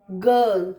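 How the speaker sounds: "गर्ल" low, long uh straight into l. Shown as a demonstration. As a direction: drop the r from "गर्ल", stretch the vowel. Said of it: "ये बोलेंगे".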